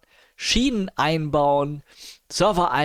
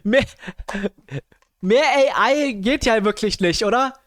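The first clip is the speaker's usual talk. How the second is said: excited; 7 semitones higher, 5 dB louder than usual. Real, not acted. Mocking the very AI voice.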